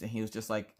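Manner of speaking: ordinary talk, nothing marked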